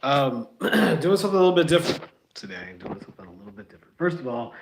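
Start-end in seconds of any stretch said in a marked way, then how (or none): 2.8-4.0 quick breathy whispered repeat. Shown as a demonstration. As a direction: whisper it fast as an aside. Repeats the line as an aside.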